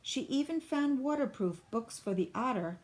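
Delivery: ordinary delivery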